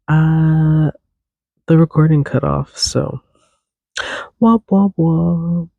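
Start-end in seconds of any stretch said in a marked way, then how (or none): none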